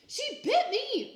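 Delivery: high, loud, slow shocked cry; startled grandmother's outcry. A narrator's reading. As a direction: yelp it in alarm. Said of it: "Grandma cried".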